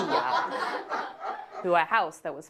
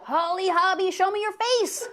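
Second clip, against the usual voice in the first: high shrill-free scream, much higher; 13 semitones higher, 3 dB louder than usual. Retold or imitated. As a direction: scream it out at the doll. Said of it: "I'd scream to myself".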